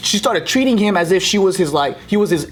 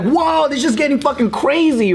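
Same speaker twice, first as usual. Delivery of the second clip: imitated onlooker, pitched up; alarmed amazement; 8 semitones higher than usual. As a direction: react wide-eyed as things escalate. Onlookers' reaction, retold.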